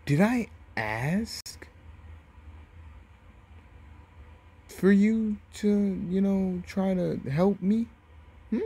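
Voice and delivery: high-pitched, incredulous taunting; acted scornful disbelief, voice climbing high; mocking sarcasm. Naruto scornfully rejects Gaara's help.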